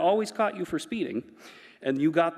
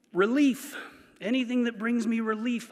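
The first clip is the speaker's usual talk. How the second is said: ordinary delivery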